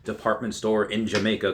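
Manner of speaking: ordinary talk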